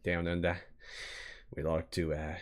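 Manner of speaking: lightly put-on Australian accent; a half-hearted Aussie twang; mock Australian accent. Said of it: "Is that an Australian critical?".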